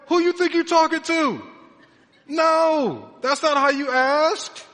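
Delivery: loud, high, indignant mock telling-off; scolding father. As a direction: rebuke the cheeky kid at full volume. Retold father scolding demanding child.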